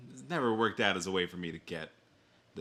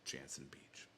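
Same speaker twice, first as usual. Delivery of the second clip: whisper; 7 semitones lower, 18 dB quieter than usual. Pretend. Whispered aside in ad reading.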